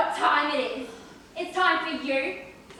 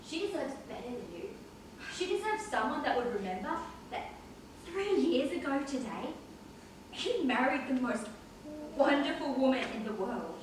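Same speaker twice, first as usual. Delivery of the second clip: quiet reveal; 4 semitones lower, 10 dB quieter than usual. Acted. Drunk brother's bitter reveal.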